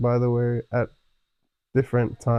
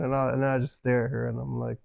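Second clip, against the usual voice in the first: ordinary delivery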